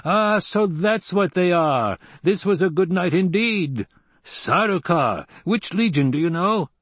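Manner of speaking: high-pitched, delighted Fremen warrior; gleeful. A narrator's reading. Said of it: "there appeared to be glee in his voice".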